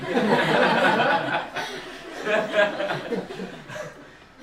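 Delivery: long burst of loud laughter; real, prolonged laugh at a joke. Real, not acted. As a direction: laugh hard and let it run on. Laughing after own joke.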